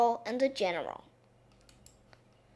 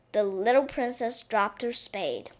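ordinary delivery, nothing marked

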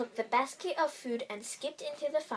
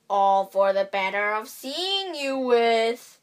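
put-on the wolf faking an old lady's quaver; strange wobbly voice; 7 dB louder, slower than usual. A narrator's reading. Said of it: "in the same wobbly voice".

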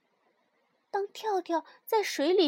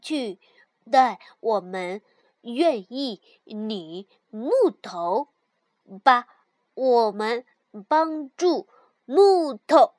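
backwards speech; 7 dB louder, slower than usual. A narrator's reading. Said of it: "他们开始练习倒着说话".

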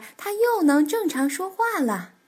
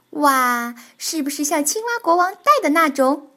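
loud, higher wow of wonder; marveling; 3 semitones higher, 5 dB louder than usual. Acted. Tiaotiao imagining a frog king's crown, marveling loudly. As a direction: gasp with delight. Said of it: "跳跳惊叹道".

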